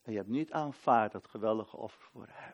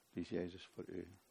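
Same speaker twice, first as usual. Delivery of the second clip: much softer and lower, searching; soft repeat; 6 semitones lower, 13 dB quieter than usual. Acted. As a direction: let the question sink in quietly. Question repeated softly.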